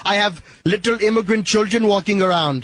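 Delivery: a light, hammed-up Indian accent; put-on Indian accent. Prank caller quizzing the Irishman, in a light mock Indian accent. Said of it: "I don't hear too many Americans talking like that".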